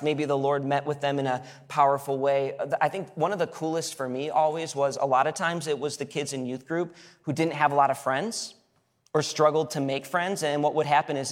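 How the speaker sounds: ordinary talk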